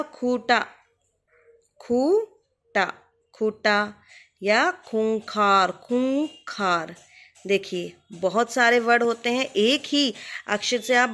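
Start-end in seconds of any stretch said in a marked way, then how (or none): 1.8-2.9 खूटा with a very slow, stretched खू; drawn-out long oo. Shown as a demonstration. Teacher stretching the long vowel slowly, drawn-out खूटा. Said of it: "बड़ा खू से क्या आएगा". 4.4-6.9 खूंखार said slowly with a long खू. Shown as a demonstration. Teacher modelling the long vowel slowly, stretched खूंखार. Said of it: "बड़ा खू से".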